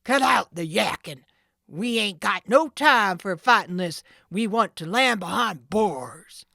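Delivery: loud gruff command, fading to a hiss; leader interposes. Acted. Sid silencing the quarrel, gruff. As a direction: shut the squabble down. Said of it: "the leader interposed".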